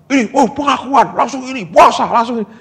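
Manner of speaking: high, loud mock of quick-fix Christians; hammed-up imitation of eager churchgoers; mocking eager believers. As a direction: parody the ones who rush to rituals. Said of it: "kalau banyak orang kan".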